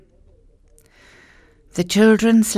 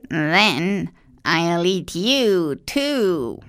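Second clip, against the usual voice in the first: put-on slow, gloating old witch; much slower than usual. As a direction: savour every word like a greedy witch. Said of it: "she told Gretel".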